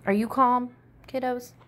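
ordinary delivery

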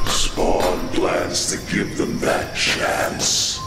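ordinary talk, nothing marked

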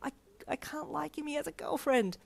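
high, stammering near-whisper; panicked inner voice. Acted. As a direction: whisper it in dismay. Inner panic after realizing a crush.

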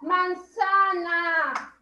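manzana drawn out, very slow; slow syllable-by-syllable. Shown as a demonstration. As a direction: spell the beats out slowly. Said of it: "Colorea los sonidos". (manzana muy lenta, sílaba por sílaba)

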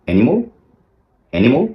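অ্যানিমাল ending in a short "mol"; correct animal. Shown as a demonstration. Teacher repeats the right অ্যানিমাল. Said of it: "মাল না বলে".

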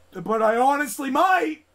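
exasperated yell on might; raging outburst. Real, not acted. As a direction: let the frustration burst out. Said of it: "I don't wanna rage".

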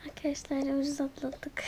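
unvoiced relieved whisper. Real, not acted. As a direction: whisper it to the camera. Relieved aside after he leaves.

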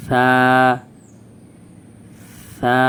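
"সা" as a gentle lisped Arabic tha; tongue tip to front teeth, release "সা" softly; long "সা" with a thin, soft consonant; soft, thin pronunciation. Shown as a demonstration. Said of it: "এইভাবে বলতে হবে".

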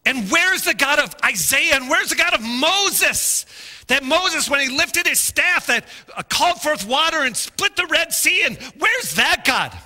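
high, loud pleading cry; crying out to God. Retold or imitated. Preacher retelling his prayer, crying out. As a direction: call out to God in desperation. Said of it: "I'm just crying out to God".

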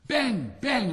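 high, loud gleeful bangs; crackers banging. Acted. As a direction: pop them out with glee. Crackers going off.

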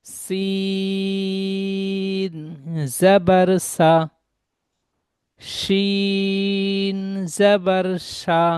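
each letter drawn out slowly and sung; slow teacher's chant of the alphabet; slow spelling drill. Shown as a demonstration. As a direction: chant the letters with their vowel, stretching every name. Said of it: "তাহলে এখানে পড়বেন".

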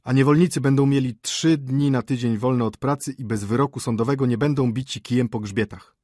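władczy książę. Acted. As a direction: proclaim decrees with regal force. Dictates royal edicts. (władczo, donośnym głosem)

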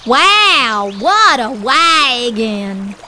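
a huge whale marveling slowly; Whale's voice. Acted.

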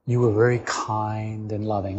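normal talking voice

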